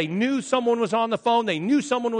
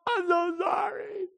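high, drawn-out pleading wail; mock-hysterical apology, pitched way up; groveling daughter; 10 semitones higher, 6 dB louder than usual. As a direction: beg mother's forgiveness, hammed up.

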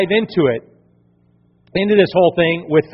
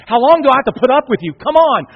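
higher, louder, fast scolding; imitating frustrated Jesus; 7 semitones higher, 5 dB louder than usual. Jesus scolding the pastor, in loud mock frustration. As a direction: nag him with mock impatience. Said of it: "Jesus probably said".

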